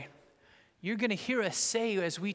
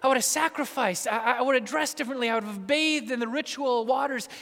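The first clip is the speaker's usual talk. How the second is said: louder, higher caught-out pleading; panicked prophet; 7 semitones higher, 11 dB louder than usual. Acted. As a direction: plead like a man caught unready. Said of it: "as if Isaiah is saying".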